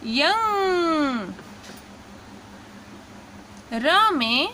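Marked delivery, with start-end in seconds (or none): 0.0-1.3 यंग stretched very slowly, nasal ending held; drawn-out syllable. Shown as a demonstration. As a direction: sound out यंग slowly for the class. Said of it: "अंग की मात्रा मिलाएंगे".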